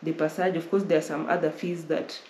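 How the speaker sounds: ordinary talk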